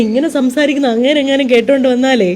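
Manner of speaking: ordinary delivery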